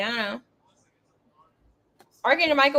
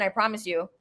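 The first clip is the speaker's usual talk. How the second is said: normal talking voice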